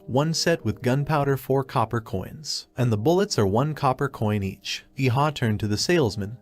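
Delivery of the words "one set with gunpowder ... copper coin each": usual speaking voice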